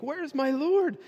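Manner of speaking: high-pitched distressed question, no sobs; mock weeping. Mary at the empty tomb looking for her Lord, mock weeping. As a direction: ask it high, as a distraught mourner. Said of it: "Mary's standing there weeping".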